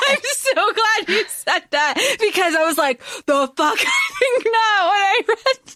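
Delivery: high, laughing half-shout; laughing outburst. Real, not acted.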